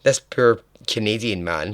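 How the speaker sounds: ordinary delivery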